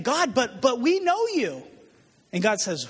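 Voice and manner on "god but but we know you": whiny plea. Retold or imitated. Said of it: "they're saying".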